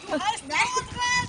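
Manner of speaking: drawn-out shouted correction; slow loud correction. Acted. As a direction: stretch each word out loud. Corrects name loudly.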